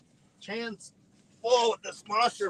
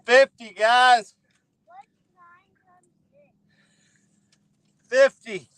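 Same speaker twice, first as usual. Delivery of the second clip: shouting over machinery; 5 semitones higher, 7 dB louder, slower than usual. Real, not acted. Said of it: "I can't hear my son".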